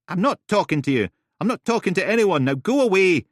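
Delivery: loud, high, stubborn; shouted through door. Acted. Woman yelling back through the door, stubborn. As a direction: yell it back through the wood.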